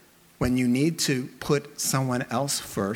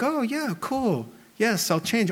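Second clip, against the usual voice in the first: mock eager agreement; 7 semitones higher than usual. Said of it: "do you immediately think".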